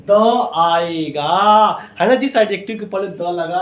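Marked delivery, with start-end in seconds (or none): 0.0-1.8 slow answer. Shown as a demonstration. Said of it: "क्या आएगा sir?".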